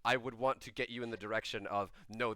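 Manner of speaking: usual speaking voice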